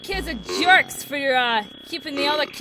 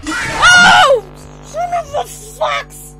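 very high, loud scream into a slow high curse; shriek, then squeeze out a mock curse; startled shriek; 12 semitones higher, 9 dB louder, much slower than usual. Real, not acted. Player cut off by Foxy, startled shriek. Foxy jumpscare cuts speech off.

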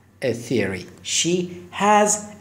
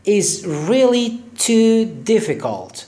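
"is really too difficult" slow and a bit higher; slow demo; 5 semitones higher, much slower than usual. Shown as a demonstration. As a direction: stretch it out for learners. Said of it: "slow teaching demo". (آهسته و شمرده با صدای کمی بالاتر)

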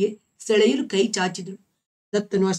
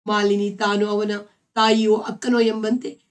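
ordinary delivery, nothing marked

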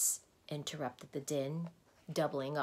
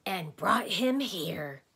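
slow, louder and exasperated; 4 semitones higher, 7 dB louder, slower than usual. A narrator's reading. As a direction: press on with strained patience. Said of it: "continued the exasperated Discord".

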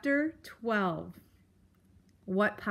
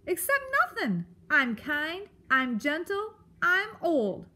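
high, emphatic self-defence; indignant protest; 7 semitones higher, 3 dB louder than usual. A narrator's reading. Porcupine defending his nature, indignant. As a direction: cut in high, then insist phrase by phrase. Offended porcupine cuts mouse off.

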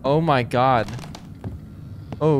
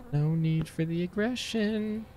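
slow, hushed, higher-pitched plea; soft placating; 4 semitones higher, 7 dB quieter, slower than usual. Real, not acted. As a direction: softly placate an aggressor, drawing it out. Placates the hostile game.

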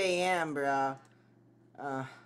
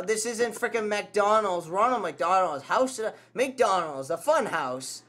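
usual speaking voice

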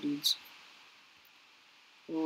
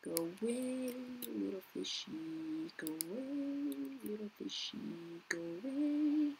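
soft coaxing; 2 semitones higher, 10 dB quieter, slower than usual. Real, not acted. Coaxing a small fish away.

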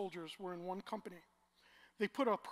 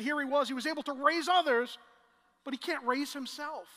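mocking crowd; 6 semitones higher, 11 dB louder than usual. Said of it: "They said".

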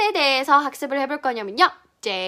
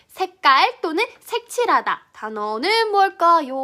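ordinary delivery

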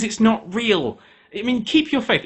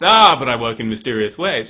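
lower, smug and sly; smug God excuse; 6 semitones lower, 5 dB louder than usual. Acted. God on The Apprentice dodges the loss, smug and lower. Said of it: "he went".

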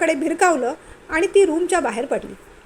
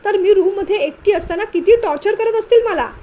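rising, louder tearful wail; wailing complaint; 5 semitones higher, 5 dB louder than usual. Acted. Wife wailing to her in-laws about her husband, tearful. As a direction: complain to the elders almost bawling. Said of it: "जवळजवळ तिने भोकाड पसरलं होतं".

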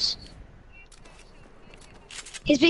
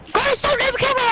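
panicked plea; 10 semitones higher, faster than usual. Real, not acted. Pleading under fire.

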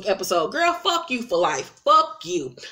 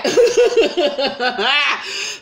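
loud high laugh; bright loud cackle; cackling laugh; 6 semitones higher, 3 dB louder than usual. Real, not acted. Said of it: "laughing at her own plea".

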